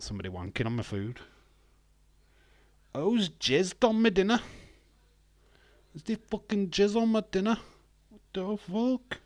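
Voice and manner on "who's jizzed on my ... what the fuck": high-pitched indignant complaint; hammed-up, incredulous protest; mock outrage. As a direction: squawk it like an appalled diner. Acts out complaint about his dinner.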